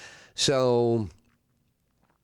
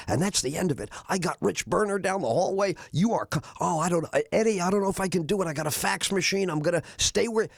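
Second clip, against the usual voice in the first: high, rushed pleading voice; a boss panicking not to lose his hire; pitched up and urgent, imitating the boss; frantic boss; 7 semitones higher, faster than usual. Jay frantic, trying to steal him.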